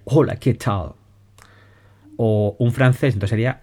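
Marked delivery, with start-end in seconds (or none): 0.1-0.9 Spanish greeting "Hola, ¿qué tal?" with an English accent, "tal" like "chal"; mock English accent. Shown as a demonstration. Teacher demos Scratch reading aloud in an English accent. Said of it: "lo lea un inglés".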